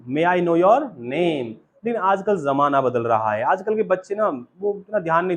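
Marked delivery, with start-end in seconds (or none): 0.1-1.5 slow polite request. Shown as a demonstration. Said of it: "बहुत खुश होगा वो जब बोलेंगे".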